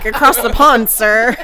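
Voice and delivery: loud fake-British declaration; hammed-up British accent; mock British accent. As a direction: play an English gent, loudly.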